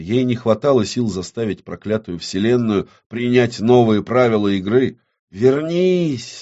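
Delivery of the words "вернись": high-pitched desperate call; crying out. Acted. Оксана calls the lost world back, crying out. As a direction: cry out to bring it back. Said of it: "закричала Оксана".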